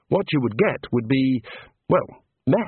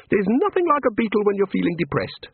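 high, bright small-god voice; cheerful little god; 9 semitones higher than usual. A narrator's reading. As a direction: play an eager little craftsman god. God character voice.

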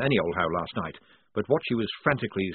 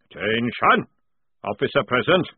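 louder parade-ground call; barked command; 2 semitones higher, 5 dB louder than usual. A narrator's reading. Jackram announcing the officer to the squad, barked. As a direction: snap them to attention.

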